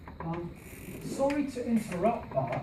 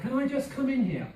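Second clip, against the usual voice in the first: a little higher and louder, stress on I; model with power; 6 semitones higher, 4 dB louder than usual. Shown as a demonstration. Teacher leading the class repeat with power. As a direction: give "I" a bit of power. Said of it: "Say it together".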